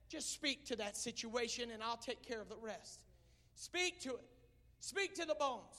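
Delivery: hushed Bible reading. Acted. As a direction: read the prophet's words hushed and solemn. Soft scripture reading.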